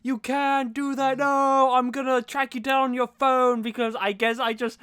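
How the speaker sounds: put-on high-pitched, overwrought possessive boyfriend; Harden impression. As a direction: ham up a controlling lover, pitched well up. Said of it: "Harden's like".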